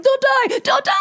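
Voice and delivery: high, frantic shrieked plea; re-enacted desperate cry; imitated panicked screaming. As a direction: scream it as if begging a child to hold on. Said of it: "Like, I'm screaming".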